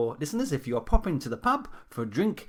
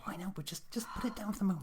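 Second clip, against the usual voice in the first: whispering; 2 semitones higher, 8 dB quieter, faster than usual. Acted. Whispered scolding on air.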